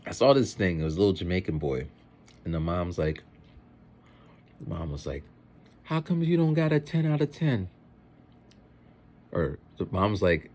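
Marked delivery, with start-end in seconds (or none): 5.8-7.7 higher-pitched, lightly voiced mom; mom questioning her son. Mom to her little boy about homework, lightly imitated questioning. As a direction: put on a mildly nagging mom, pitched up.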